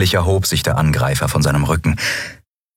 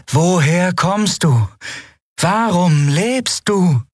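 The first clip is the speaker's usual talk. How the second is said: high, slow lilting alien voice; melodic sing-song accent; 11 semitones higher, slower than usual. A narrator's reading. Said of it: "mit einem merkwürdigen Akzent, einem melodischen Singsang mit weichem R".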